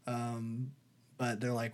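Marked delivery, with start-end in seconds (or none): none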